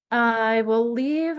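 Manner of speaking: usual speaking voice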